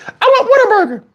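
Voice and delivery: put-on high, whiny little-girl voice. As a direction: play the hungry young daughter. Daughter voiced demanding food.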